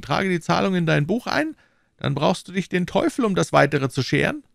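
höher gesprochener, drängender McFarland; überredet den Freund mit Nachdruck; McFarland, pushing hard. Acted. McFarland voiced. (McFarland drängt Fettes, höhere eindringliche Stimme)

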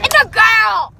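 indignant shout. Real, not acted. Corrects the dog's sex.

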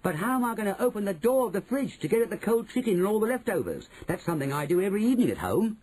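put-on high-pitched, eager boy's voice; high voice, anxious for leftovers; fretting boy. A narrator's reading. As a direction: worry greedily about getting at food. Bruno frets over reaching the fridge.

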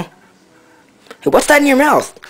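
high, loud voice for the dog; high pet voice. Acted. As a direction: squeal it at the dog. Talking to dog.

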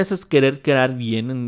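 normal talking voice

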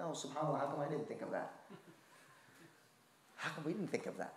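soft, hushed wonder to himself; amazed murmur. Retold or imitated. Family member marvelling at grandmother's solution, hushed amazement. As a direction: marvel quietly as if it just dawned on you.

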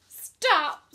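high, very loud cry to stop; screamed. A narrator's reading. Pig cuts off the old sheep, screaming. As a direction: scream to cut the speaker off. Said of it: "screamed Wilbur".

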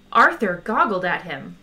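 plain reading voice

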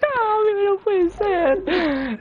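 high anguished laughing cry; laugh-cry in disbelief; despairing laugh. Real, not acted. Goal missed, despairing laugh.

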